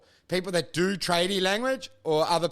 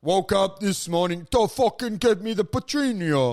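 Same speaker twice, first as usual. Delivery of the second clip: gangster Italian. Retold or imitated. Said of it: "And then there's the other".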